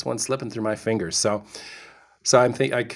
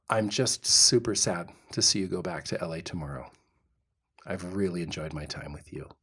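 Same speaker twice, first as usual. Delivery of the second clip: tender confession; 5 semitones lower, 8 dB quieter than usual. Retold or imitated. Husband reliving his confession to his date, soft and tender. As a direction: quietly lay your heart on the line.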